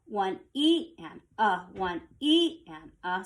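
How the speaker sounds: slow count, "E" lifted above the other syllables; accent on E. Shown as a demonstration. Teacher showing the syncopated accent, slow count stressing "E". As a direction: count slowly and lean on "E". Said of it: "there's a little accent that happens there".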